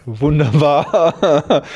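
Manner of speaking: drawn-out, sarcastic "wunderbar" with a laugh; ironic wunderbar. Real, not acted. Young electrician mocks the question about politics, ironic and laughing. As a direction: stretch the word slowly, mocking the topic.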